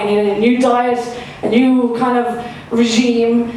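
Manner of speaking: normal talking voice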